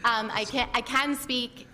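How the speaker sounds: ordinary talk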